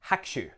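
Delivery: Hakushu said as HAK-shu, two quick beats; swallowed U. Shown as a demonstration. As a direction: drop the u after the k in Hakushu. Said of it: "the U of the ku gets a little bit swallowed up".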